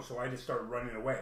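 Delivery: normal talking voice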